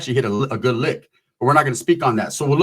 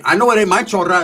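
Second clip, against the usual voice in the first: ordinary delivery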